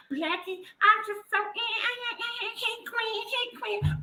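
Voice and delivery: high, breathy mocking coo of "hey queen"; mocking sister-girl voice. High cooing mock voice mocking a fake queen. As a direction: coo it like a fawning poser mocking those who call themselves queens.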